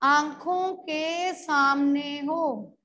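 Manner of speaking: half-speed, drawn-out dictation; slow dictation. Shown as a demonstration. Teacher dictating a definition to the class, slow dictation. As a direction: spell it out slowly for pupils writing.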